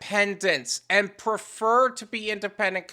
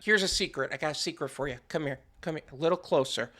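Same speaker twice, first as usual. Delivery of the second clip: hushed, lower lead-in to a secret; mock confiding; 5 semitones lower, 8 dB quieter, much faster than usual. Host luring listeners closer with a secret, mock confiding. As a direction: draw them in softly before the blast.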